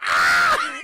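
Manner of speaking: long, loud mock scream; imitated pain scream. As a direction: scream as if just stabbed.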